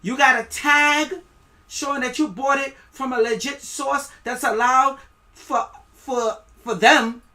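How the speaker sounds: loud, high, aggressive enforcer shouting; mock official demand. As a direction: bark it like a thug seizing your food. Acted official seizing your vegetables.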